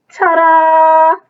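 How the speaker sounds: high, loud showy ta-da; stylish ta-da. Acted. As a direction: present it with a flourish. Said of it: "say something with style".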